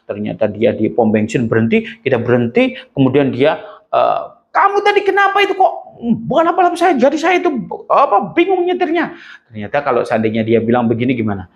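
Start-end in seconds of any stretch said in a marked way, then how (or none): 4.5-9.1 mock complaint. Driver confronting another driver.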